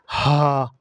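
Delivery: হা from the empty space of the mouth, airy; open Arabic Ha. Shown as a demonstration. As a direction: let হা come from the open mouth. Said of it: "এভাবে উচ্চারণ করতে হবে".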